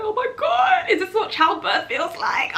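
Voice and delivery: high, loud mock wail; anguished outburst. Patient in pain mid-procedure, wailing dramatically.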